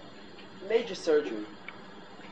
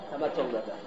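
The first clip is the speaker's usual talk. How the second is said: ordinary talk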